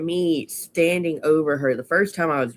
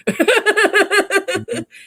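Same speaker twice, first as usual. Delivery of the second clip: laugh; 6 dB louder than usual. Real, not acted. Laughing at daughter's reply.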